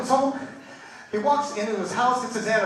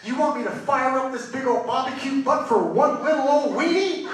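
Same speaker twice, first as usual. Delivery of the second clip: raised, louder mock disbelief; acted wife's scornful disbelief; incredulous comeback; 5 semitones higher, 4 dB louder than usual. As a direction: build to a high questioning finish.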